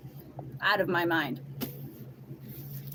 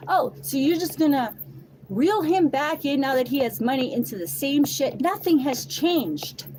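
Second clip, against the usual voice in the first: louder, higher, incredulous challenge; indignant confrontation; 6 semitones higher, 5 dB louder than usual. Retold or imitated. Confronting the woman texting her, indignant. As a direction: call out the hypocrisy, voice raised.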